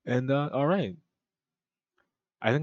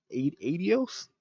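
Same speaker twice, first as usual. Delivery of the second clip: higher, questioning try at Spanish; 7 semitones higher, slower than usual. Shown as a demonstration. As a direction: fumble a Spanish accent, unsure.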